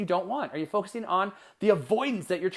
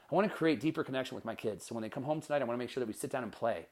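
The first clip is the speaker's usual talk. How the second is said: softer, lower, sincere parent; gentle resolve; 5 semitones lower, 7 dB quieter, faster than usual. Acted. Parent planning tonight's play with the kids, gentle and soft. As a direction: drop low and soft, a tender promise.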